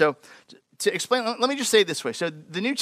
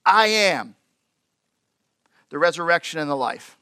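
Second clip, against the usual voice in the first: solemn declaration. Acted. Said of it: "Jesus said to her".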